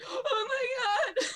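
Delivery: put-on high, far-off sobbing girl voice, laughing through tears; overwhelmed rescued girl, crying and laughing at once. Ronnie sobbing in relief, GM voice.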